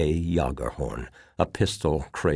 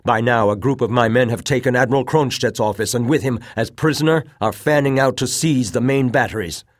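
loud, higher, insistent defiance; shaken but resolute; 6 semitones higher, 5 dB louder than usual. A narrator's reading. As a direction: press the claim defiantly. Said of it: "shaken but still resolute".

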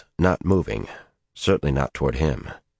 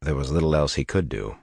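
ordinary delivery

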